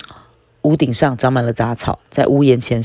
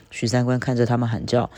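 ordinary delivery, nothing marked

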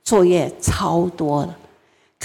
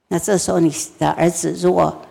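normal talking voice

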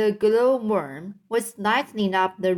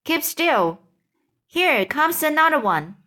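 urgent; 6 semitones higher than usual. A narrator's reading. Urgent warning.

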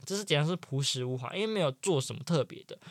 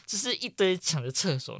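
ordinary delivery

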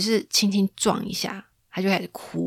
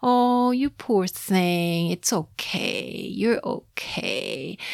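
soft, very slow cooing; grandma soothing a sobbing baby in her arms; gentle soothing; 3 dB quieter, much slower than usual. Retold or imitated. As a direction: rock the crying baby with your voice. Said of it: "就是非常的nice".

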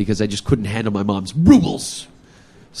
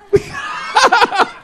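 shrieking laugh. Real, not acted. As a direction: let out a whooping shriek of laughter. Laughing at punchline.

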